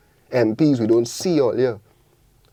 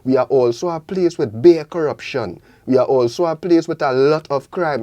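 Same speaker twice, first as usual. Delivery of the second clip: ordinary talk, nothing marked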